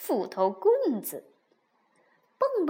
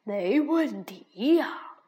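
smiling bear; 6 semitones lower than usual. A narrator's reading. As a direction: kindly reassure them. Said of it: "大熊微笑着说".

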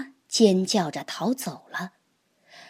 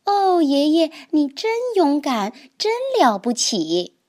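put-on excited child cry; 6 semitones higher than usual. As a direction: cry out in awe of grandpa. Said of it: "他叫道". (小蛤蟆高声惊叹爷爷)

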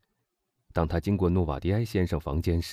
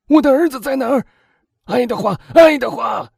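very loud, high panicked calling; frantic shout; 11 dB louder than usual. A narrator's reading. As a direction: shout desperately for your missing son. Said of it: "他突然喊道". (惊恐地高声呼喊)

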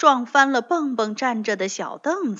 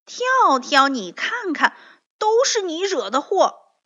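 high, loud, accusing voice; loud scolding; 7 semitones higher, 6 dB louder than usual. A narrator's reading. Bengbeng blames Tiaotiao for the mess, scolding loudly. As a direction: lay the blame loudly, pitched up. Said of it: "蹦蹦大声责备着说".

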